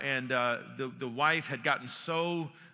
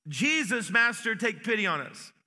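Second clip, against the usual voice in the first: higher, raised plea, calling from afar; calling out loudly; 6 semitones higher, 3 dB louder than usual. A narrator's reading. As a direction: cry out for pity across the distance. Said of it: "they called out in a loud voice".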